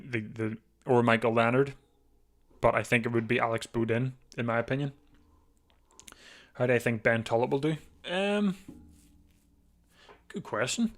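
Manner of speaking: ordinary talk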